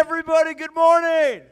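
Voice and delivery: high, loud call to the crowd. Real, not acted. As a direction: project it to the whole room. Host calls out to crowd.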